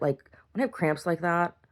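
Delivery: ordinary talk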